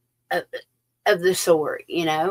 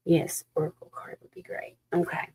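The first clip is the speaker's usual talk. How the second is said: soft, breathy whisper; whispered aside during a reading; 7 dB quieter than usual. Real, not acted. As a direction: hush it down to a confiding whisper.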